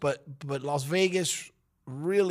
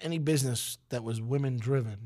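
ordinary talk

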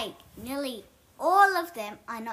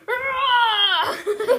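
loud, high roaring cry; mock roar; 11 semitones higher, 6 dB louder than usual. Showing friends how they jump in lava, mock roar. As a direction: let out a big silly roar. Demonstrates the disliked jumping noise.

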